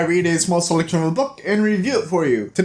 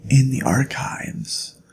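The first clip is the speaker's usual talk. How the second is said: whispered, softer, like in a quiet archive; hushed library whisper; 3 semitones lower than usual. Shown as a demonstration. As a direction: drop to a courteous whisper so others can study. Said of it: "talk in a quiet".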